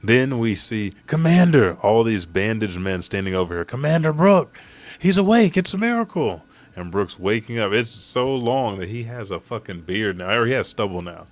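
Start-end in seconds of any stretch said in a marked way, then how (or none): 1.1-1.7 loud, high acted soldier's cry; calling out. Retold soldiers calling their commander. 3.6-6.4 loud, very high amazed cry; excited shout. Acted. Retold soldiers exclaim at his waking.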